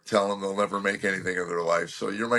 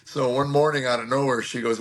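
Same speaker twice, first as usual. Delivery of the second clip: ordinary talk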